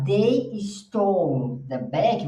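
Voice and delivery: very slow, clear classroom read; slow model sentence. Shown as a demonstration. Teacher reads an example sentence to the class. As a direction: spell it out word by word for learners. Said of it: "Se você falar".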